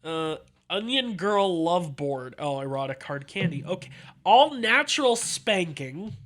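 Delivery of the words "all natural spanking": slow, louder, higher amused read; drawn-out amused disbelief. Real, not acted. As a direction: savor each word, amused. Reading listing amused.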